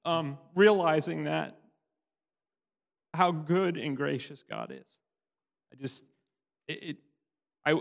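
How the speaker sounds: fighting back tears while telling it; tearful testimony. Real, not acted. Speaking to the church, choked up with real tears. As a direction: voice lifted and breaking with emotion. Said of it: "like I'm doing now".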